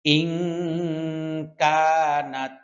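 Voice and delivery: slow melodic chanted recitation; Quran recitation. Shown as a demonstration. Teacher chanting verse slowly for students. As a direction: chant each word drawn out for students to repeat.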